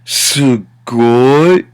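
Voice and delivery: "sugoi" drawn out with a rising finish; exaggerated. Shown as a demonstration. Teacher shows how it can get exaggerated, hugely stretched "sugoi". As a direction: blow "sugoi" up into a long wow. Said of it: "how it can really get exaggerated".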